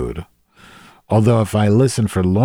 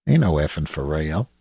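ordinary talk, nothing marked

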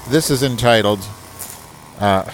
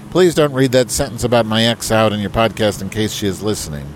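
email read aloud; 2 semitones higher, 3 dB louder than usual. Acted. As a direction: put on a proper, correspondence tone. Reads email.

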